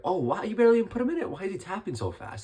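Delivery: high, fast burst of disbelief that fades; amazed disbelief. Retold or imitated. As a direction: blurt it out like you can't believe the tap. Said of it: "You're like".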